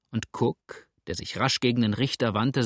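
ordinary delivery

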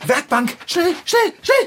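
high, loud hurried urging; frantic hurry. Acted. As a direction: rush the cat under the bench. Hastiges Verstecken. (hektisches Drängen, hoch und laut)

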